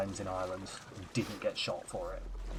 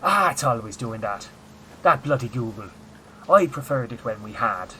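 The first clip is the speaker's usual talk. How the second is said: louder Irish comedian grumble about Google; Dara O'Brien; 8 dB louder than usual. Retold or imitated. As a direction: grumble like a chatty Irish guard, much louder. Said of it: "I'm Dara O'Brien now".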